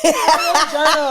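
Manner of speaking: drawn-out laughing "You journal"; laughing mock. Real, not acted. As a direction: laugh it out slowly, teasing. Mocking friend's journaling.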